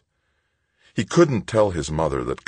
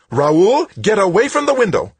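much higher, much louder motherly scold; scolding shout; 9 dB louder than usual. A narrator's reading. Mother yells at son to leave window, scolding shout. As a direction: snap at him like he's a child again. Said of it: "tone of voice he remembered from childhood".

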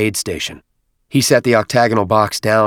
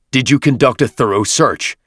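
higher, louder stern interrogation; stern questioning; 5 semitones higher, 6 dB louder than usual. Acted. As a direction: press him hard. Chieftain interrogates nephew.

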